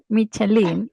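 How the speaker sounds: "Michelin" said the Spanish way; "Michelin" with Spanish sounds, mee-cheh-LEEN; Spanish pronunciation. Shown as a demonstration. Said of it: "literally in Spanish".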